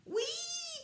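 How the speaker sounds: high, loud gleeful cry; gleeful whee. A narrator's reading. Rabbit skis down the banisters, whooping. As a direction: shoot past shouting with glee. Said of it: "cried Rabbit".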